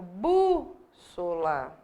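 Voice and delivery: "bússola" with BÚ drawn out and punched, then a gap; tônica destacada. Shown as a demonstration. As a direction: hold the first syllable of "bússola", then drop "ssola". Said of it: "a tônica tá aqui". ("bússola" com BÚ forte e alongado, pausa antes do resto)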